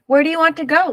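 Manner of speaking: loud, high call; calling from the sled; hollered. A narrator's reading. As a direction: shout it across the snow. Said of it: "the driver hollered".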